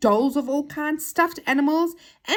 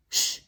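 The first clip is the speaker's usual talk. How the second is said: hushing shh. Acted. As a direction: hush everyone with a drawn-out shh. Character hushes the others.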